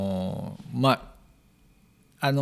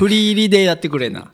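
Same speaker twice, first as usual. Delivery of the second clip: teasing raised voice; 7 semitones higher, 5 dB louder than usual. Real, not acted.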